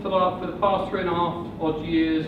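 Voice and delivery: ordinary talk, nothing marked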